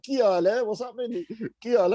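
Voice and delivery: high, playful Punjabi bro repeat; cheeky desi greeting. Pretend. Repeating the greeting to puzzled Punjabi men, hammed-up and cheeky. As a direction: grin and say it again to the confused men.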